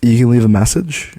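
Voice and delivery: high loud teasing sing-song. Acted. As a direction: needle her playfully. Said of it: "I tease my junior".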